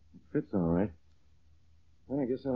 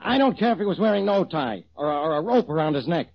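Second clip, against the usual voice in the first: fast, rising exasperated tirade; angry rant; 7 semitones higher, 3 dB louder than usual. Acted. Inspector ranting at Rollins, exasperated and loud. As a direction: pile on the outrage, faster and louder.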